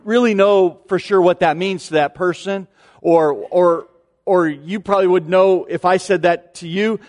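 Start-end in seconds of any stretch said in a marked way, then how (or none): none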